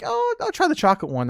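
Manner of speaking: high, eager younger self. Retold or imitated. Grandson happily choosing a cookie, high and eager. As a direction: pick the cookie brightly. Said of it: "I'm like".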